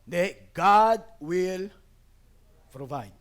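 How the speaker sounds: slow firm reassurance. Retold or imitated. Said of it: "Sabi ko".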